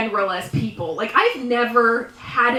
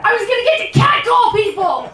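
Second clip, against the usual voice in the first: high excited shout about catcalling; 10 semitones higher, 3 dB louder than usual. Real, not acted. Comic shouting her glee at the crowd, high and excited. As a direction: burst out high and loud.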